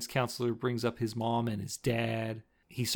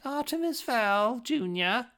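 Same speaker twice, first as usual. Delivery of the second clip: high, drawn-out put-on teacher voice; teacher calling out; 11 semitones higher, slower than usual. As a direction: mimic a teacher summoning a pupil in singsong. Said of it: "the teacher's like".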